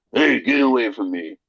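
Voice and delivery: lightly voiced tough guy; a hint of his own gruff gym voice; self impersonation. Mimicking himself telling gym guys off, lightly voiced. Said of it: "That's what I sound like in my mind".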